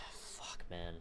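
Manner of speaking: a soft unvoiced curse, overcome; quiet, choked whisper. Real, not acted. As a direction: breathe the word out under your breath. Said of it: "I'm trying not to cry at the moment".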